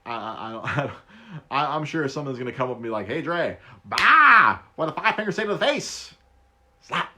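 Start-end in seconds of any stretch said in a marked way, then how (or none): 3.1-7.1 loud hammed-up sketch voice; mock slap line, loud and high; Chappelle sketch quote. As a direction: blurt it like a comedy bit. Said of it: "Too much Chappelle".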